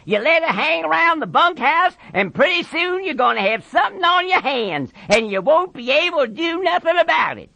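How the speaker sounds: high, strained ranch-hand voice; goading Carlson. Acted. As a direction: needle him, pitched high and forceful. Carlson goads Curly.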